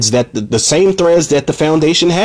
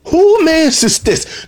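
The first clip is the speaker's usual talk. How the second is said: "Who mans is this?" pushed much higher and slower on "Who"; more incredulous; 13 semitones higher, much slower than usual. Retold or imitated. Reviewer repeating his question about Darius, higher and slower. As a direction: stretch "Who" up high in stunned disbelief. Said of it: "I'm like, I'm thinking".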